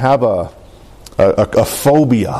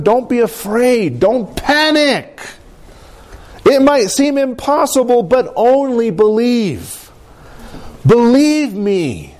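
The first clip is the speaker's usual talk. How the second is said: earnest reassurance; 9 semitones higher, slower than usual. Retold or imitated. Jesus voiced, preaching climax.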